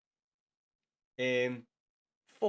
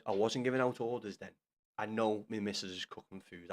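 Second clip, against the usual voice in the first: ordinary talk, nothing marked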